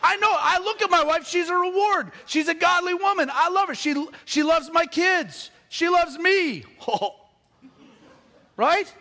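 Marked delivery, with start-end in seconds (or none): none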